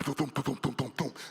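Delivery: low, repeated tumbling thumps; imitated thumping. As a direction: sound out a body bumping away. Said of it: "next thing you hear is".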